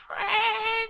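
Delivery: very high squeaky cry; goblin voice. Acted.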